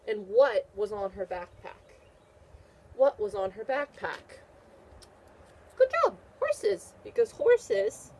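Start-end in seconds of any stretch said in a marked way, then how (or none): 5.8-8.1 much higher, louder, delighted; bright high teacher praise; excited praise. Real, not acted. Praising child's answer.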